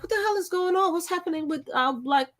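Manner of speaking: high, incredulous what-is-going-on; baffled outburst. Retold or imitated. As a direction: throw it up high in disbelief. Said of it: "And I'm like".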